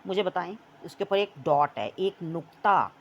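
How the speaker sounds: ordinary delivery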